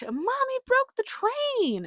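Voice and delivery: put-on high, slow excited child yell; child's shout again. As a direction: announce it like a thrilled little kid. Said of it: "oh my gosh".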